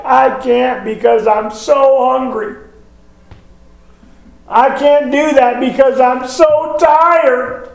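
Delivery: mock whiny little girl, pitched high; granddaughter's excuse. Granddaughter making excuses to parents, mock whine.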